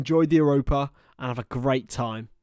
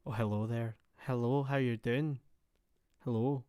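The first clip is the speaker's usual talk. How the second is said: quiet, softly put-on Scottish lilt; soft Scottish accent; 5 dB quieter than usual. Streamer imitating the women's accents he loves, soft Scottish. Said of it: "if they're soft as well".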